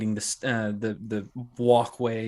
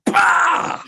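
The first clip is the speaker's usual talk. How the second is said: loud, high shouted bang noise; explosive mouth-made slam; 9 semitones higher, 11 dB louder than usual. Acted. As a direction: imitate a door knocker slammed hard. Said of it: "slams it against the door real hard with a loud".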